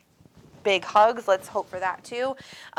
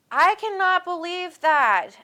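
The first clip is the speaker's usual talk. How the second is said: surprised; 11 semitones higher, 6 dB louder, slower than usual. Real, not acted. Reacting to surprising viewer comment.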